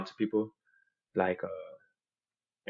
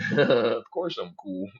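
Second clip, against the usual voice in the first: ordinary talk, nothing marked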